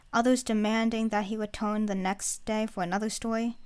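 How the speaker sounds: ordinary delivery, nothing marked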